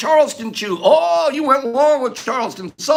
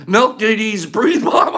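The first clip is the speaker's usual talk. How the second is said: ordinary talk, nothing marked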